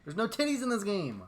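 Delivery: loud and high; loud complaint. Real, not acted. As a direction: shout the punchline. Repeating the complaint louder.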